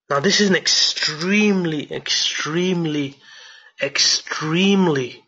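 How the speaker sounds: each "extremely" slowed and leaned on; emphasizing extremely. Shown as a demonstration. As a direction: hammer "extremely" over and over, slowly. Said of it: "I can't emphasize the word extremely".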